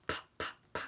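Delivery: unvoiced p, p, p puffed out; p sound. Shown as a demonstration. Teacher isolating the p sound for children. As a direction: puff the p sound three times with no voice.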